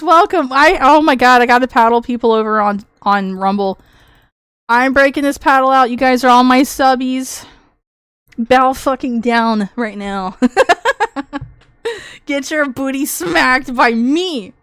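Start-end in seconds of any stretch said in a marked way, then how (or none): none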